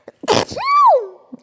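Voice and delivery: sudden loud sneeze into the mic. Real, not acted. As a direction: let a real sneeze burst out.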